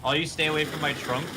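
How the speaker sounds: ordinary talk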